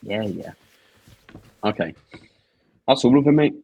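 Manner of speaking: ordinary talk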